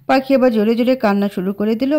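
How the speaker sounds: ordinary delivery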